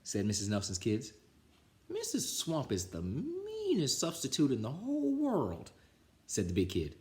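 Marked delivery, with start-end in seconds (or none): 1.9-5.7 higher, sing-song scary telling; higher spooky warning; big kid spooky tale. Acted. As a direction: spook the younger kids.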